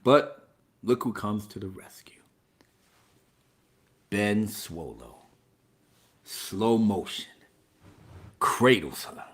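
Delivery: very soft, low near-whisper; mock-solemn hush; hushed dramatic narration. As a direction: narrate it like a solemn slow-motion scene. Narrating a tender slow-motion rescue.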